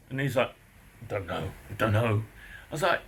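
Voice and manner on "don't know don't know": mumbling neighbour. Retold or imitated.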